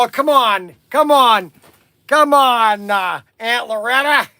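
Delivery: loud, high tipsy whine; tipsy pleading. Retold or imitated. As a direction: plead like a silly drunk, drawing attention. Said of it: "kinda getting a little silly".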